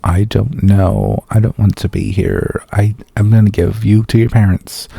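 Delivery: imitating a bored actress's disengaged delivery; Dakota Johnson. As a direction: deliver it with zero interest, dragging. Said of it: "Dakota Johnson's uninterested voice, just delivering lines like this".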